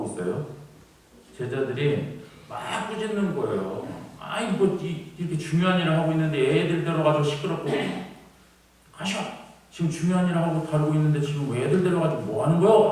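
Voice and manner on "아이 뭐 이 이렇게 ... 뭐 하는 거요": high, fast, irritated telling-off; acted scolding. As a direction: scold parents as an annoyed disciple shooing children away. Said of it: "하면서 막 이렇게 꾸짖는 거예요".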